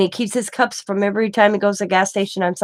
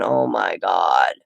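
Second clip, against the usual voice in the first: slow, much lower groan of "Oh my God"; dismayed mother-in-law; 13 semitones lower, much slower than usual. Retold or imitated. Mother-in-law looking down from heaven, slow low dismay. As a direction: drag it out low like a heavenly sigh of despair. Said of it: "mother-in-law is looking down from heaven going".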